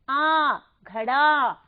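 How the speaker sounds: आ held alone, then घड़ा with a long final आ; long आ sound. Shown as a demonstration. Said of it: "आ की आवाज आ रही है".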